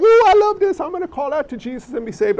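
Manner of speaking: high, loud gushing "Ooh, I love this"; mock an overeager new convert; gushing excitement. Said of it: "before you are like".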